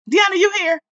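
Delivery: loud, high call. Real, not acted. Host checks whether Deanna joined, calling out. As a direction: holler to find someone in the crowd.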